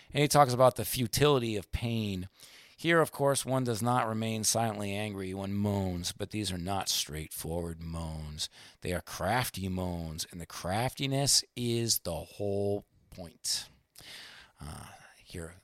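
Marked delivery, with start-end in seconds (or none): 3.5-13.7 slow reading. Acted. Reads Dostoevsky quote slowly. 14.6-15.5 whispered aside. Real, not acted. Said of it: "unvoiced whisper".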